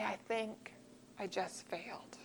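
very soft, defeated little voice; dejected admission. Retold or imitated. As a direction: let it sink away as you admit failure. Student back from bad test.

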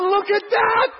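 shouted high with delight at the sight; amazed exclamation. Retold or imitated. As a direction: cry it out in happy amazement. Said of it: "I was like".

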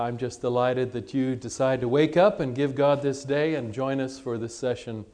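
ordinary talk

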